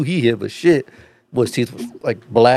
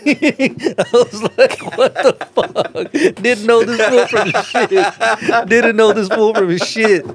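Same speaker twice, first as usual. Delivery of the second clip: high, drawn-out words through real laughter; slow, squeaky laughing delivery; cracking up; 10 semitones higher, much slower than usual. Real, not acted. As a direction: keep telling it while laughing hard. Teller cracks up at own story.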